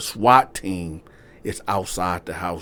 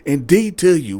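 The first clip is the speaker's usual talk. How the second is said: much higher, loud; police threat; 11 semitones higher, 4 dB louder than usual. Retold or imitated. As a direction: list the threats. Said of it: "Now they telling him".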